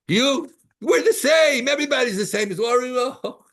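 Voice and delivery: hammed-up indignant insistence; mock indignation. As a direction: proclaim it with exaggerated outrage. Acting out scornful claim of equality.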